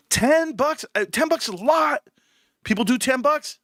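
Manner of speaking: high-pitched disbelief; real astonishment, pitched well up; incredulous. Real, not acted. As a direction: balk at the price, voice leaping up. Reacts to a ten-dollar membership.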